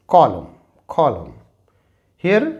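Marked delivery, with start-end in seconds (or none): 0.1-1.3 कॉलम said as kolum, the n dropped; silent n demo. Shown as a demonstration. Teacher showing students the silent n in कॉलम. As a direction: end कॉलम on m. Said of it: "और इसे हम पढ़ते हैं".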